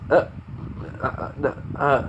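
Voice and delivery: soft, halting stammer; flustered stammer. Acted. Kaminari caught out about his crush on Jiro, flustered stammer. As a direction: stumble quietly over broken syllables.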